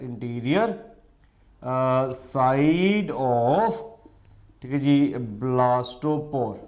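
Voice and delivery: each word drawn out for note-taking; slow dictation. Shown as a demonstration. Teacher dictates slowly to students for their notes. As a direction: spell it out slowly so they can write. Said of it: "interior side of the blastopore".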